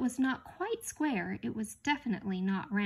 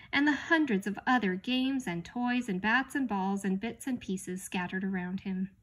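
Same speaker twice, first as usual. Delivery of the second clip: usual speaking voice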